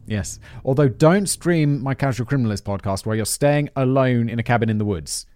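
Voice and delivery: ordinary delivery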